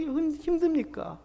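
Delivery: usual speaking voice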